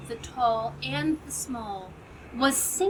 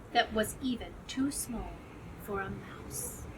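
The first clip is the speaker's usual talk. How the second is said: whisper; 3 semitones lower, 8 dB quieter than usual. A narrator's reading. Narrator hushes tiny crumb.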